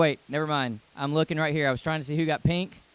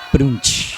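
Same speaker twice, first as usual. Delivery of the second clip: vocal rimshot; 12 dB louder than usual. Acted. Youth pastor adding a rimshot after his joke, playful. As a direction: mouth drum sting, ba-doom-tsh. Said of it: "vocal rimshot after joke".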